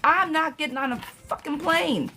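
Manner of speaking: high, emphatic no-way; emphatic refusal. Real, not acted. Reader swearing she won't fly right now, emphatic. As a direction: refuse flatly, pitch shooting up.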